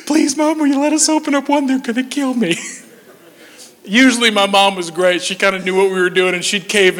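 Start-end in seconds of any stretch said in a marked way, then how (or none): 0.1-2.6 put-on high, whimpering little-kid voice; hammed-up child's crying plea; mock tearful pleading. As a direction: beg mom like a tearful little brother. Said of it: "get some tears going".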